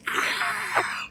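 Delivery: whispered breathy squeal; a hushed mock shriek. Strangers squealing as she leaves with her husband, whispered. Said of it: "they're like".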